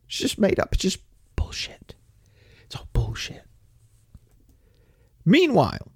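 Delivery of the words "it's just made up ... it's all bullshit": unvoiced, softer dismissive whisper. Real, not acted. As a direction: hush it down to a scornful whisper. Dismissive aside after a rant.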